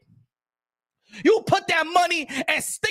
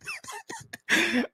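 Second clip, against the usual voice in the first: high laugh burst; 6 dB quieter than usual. Real, not acted.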